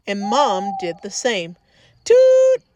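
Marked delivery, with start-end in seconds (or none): 2.0-2.6 high, loud toot like a train whistle. A narrator's reading. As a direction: blast it out like a train horn. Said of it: "yelled the drivers".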